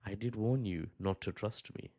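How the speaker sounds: low, softened menace in the ear; Littlefinger. Acted. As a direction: murmur it like a sly betrayer. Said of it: "whispering soft nothings in your ear".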